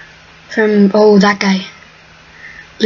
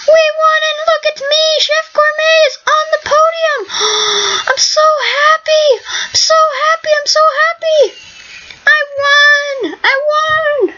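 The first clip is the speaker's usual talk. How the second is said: on the podium after winning, squealing with joy; ecstatic victory squeal. Real, not acted. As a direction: squeal like you just won the world. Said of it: "I'm way too happy right now".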